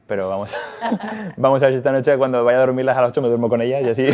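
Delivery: laughing. Real, not acted. Laughing about sleeping little.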